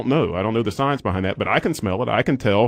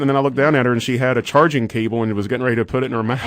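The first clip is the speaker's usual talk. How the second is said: ordinary talk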